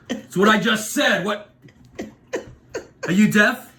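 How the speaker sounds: high-pitched, indignant acted outburst; exasperated. East Coaster to a clerk who missed his name, exasperated. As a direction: snap back in exasperation, pitch jumping up.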